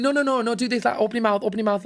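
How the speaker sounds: high, urgent mock correction; imitating a pushy mother. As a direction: correct the child insistently, as the mum. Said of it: "she's going".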